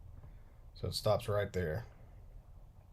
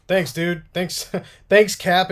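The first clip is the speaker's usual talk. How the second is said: higher, louder laughing thanks; 7 semitones higher, 12 dB louder, slower than usual. Real, not acted. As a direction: bubble over with laughter as you thank him.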